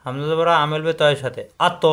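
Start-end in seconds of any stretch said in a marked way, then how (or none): 1.7-1.8 আত with a bounced final ত; qalqalah bounce. Shown as a demonstration. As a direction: release ত in আত with a short echo. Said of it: "বাড়ি খাবে".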